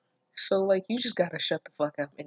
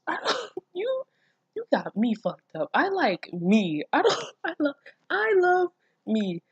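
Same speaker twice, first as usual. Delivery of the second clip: laughing rant; 10 semitones higher than usual. Real, not acted. Laughing rant about herself.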